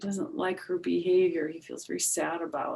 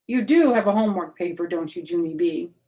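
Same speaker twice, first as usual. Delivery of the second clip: louder, fast pointed question; stern teacher; 9 dB louder than usual. Acted. Teacher pressing Junie B about her homework, loud and quick. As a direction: press the child like a suspicious teacher.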